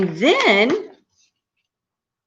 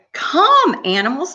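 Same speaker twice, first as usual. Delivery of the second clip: calling out to creation; 9 semitones higher than usual. Acted. God summons the animals, high sing-out call. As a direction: come sung out high.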